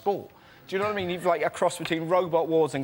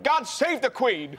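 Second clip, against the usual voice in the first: mock panic, higher and drawn out; panicked outburst; 7 semitones higher, slower than usual. Comic retelling of Don King's TV moment, mock panicked. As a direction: imitate a flustered cry, pitched up. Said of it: "he panicked and went".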